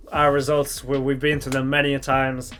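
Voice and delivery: usual speaking voice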